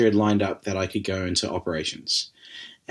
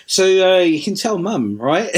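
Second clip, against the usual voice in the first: higher, louder, sheepish plea; sheepish coward; 10 semitones higher, 4 dB louder, slower than usual. Retold or imitated. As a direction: wheedle like a guilty son dodging trouble. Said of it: "the little coward I was".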